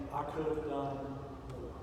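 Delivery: soft, low, hushed regretful murmur; quiet regret. Pretend. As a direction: say it softly as a quiet lament. Imagined regret before heaven.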